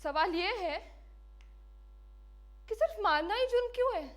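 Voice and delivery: high, loud accusing questions; impassioned challenge. Acted. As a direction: hurl the question at the world. Condemned woman challenges the world. (ऊँची आवाज़ में जोशीला सवाल)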